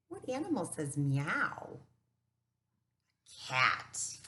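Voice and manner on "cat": plain reading voice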